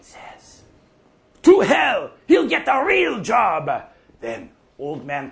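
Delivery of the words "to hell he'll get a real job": high, loud angry yell; a father shouting down the idea; furious shout. Retold or imitated. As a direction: bellow it back in disgust. Furious father rejecting son's art.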